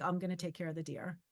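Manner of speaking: soft, low reassurance; gently imitated, unbothered calm; super calm. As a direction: quietly take charge, quoting the stranger. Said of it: "He was super calm".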